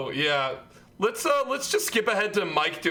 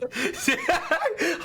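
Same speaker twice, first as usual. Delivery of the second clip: high laugh; 10 semitones higher than usual. Real, not acted. Laughing at old video.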